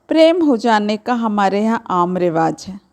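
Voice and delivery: ordinary delivery, nothing marked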